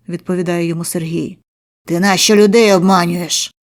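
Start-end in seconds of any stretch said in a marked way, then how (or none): 1.9-3.5 loud accusing question; angry demand. A narrator's reading. Narrator accusing the debtor, loud and angry. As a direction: bark it at him. Interrogating the victim.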